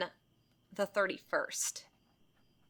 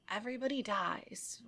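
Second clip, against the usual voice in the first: deadpan nurse; 8 dB quieter than usual. Retold or imitated. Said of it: "And she's like".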